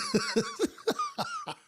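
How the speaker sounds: squealing high laugh; high burst of laughter. Real, not acted. Streamer cracking up over his childhood joke. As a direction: let a shrill laugh burst out.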